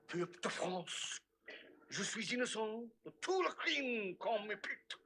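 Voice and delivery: slow, halting French oration; Louis the Sixteenth. Acted. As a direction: declaim a solemn last speech in French, with pauses. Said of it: "a speech by Louis the Sixteenth".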